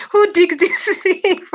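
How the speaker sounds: high, slow, laughing disbelief. Retold or imitated. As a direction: giggle out the question. Laughing quoted comment.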